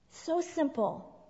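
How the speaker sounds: usual speaking voice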